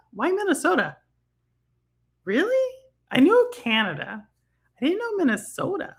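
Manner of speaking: genuinely thrown by the news; high, incredulous question; surprised. Real, not acted. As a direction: pitch jumps way up in disbelief. Surprised about fires in Minnesota.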